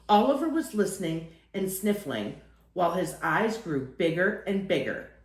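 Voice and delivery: usual speaking voice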